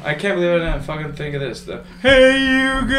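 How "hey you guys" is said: high, slow, drawn-out call; Goonies quote shout. Retold or imitated. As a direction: bellow it slow like the Goonies kid. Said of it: "The Goonies".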